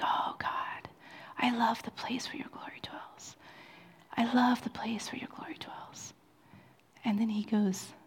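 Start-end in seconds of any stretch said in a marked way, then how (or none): 0.0-6.1 whispered, breathless prayer to God; barely voiced, awed longing; hushed prayer. A preacher reliving a whispered prayer to God.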